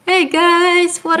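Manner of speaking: high-pitched in-character hello; feigned surprise greeting. Acted. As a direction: act out running into friends by chance. Player acts a chance meeting.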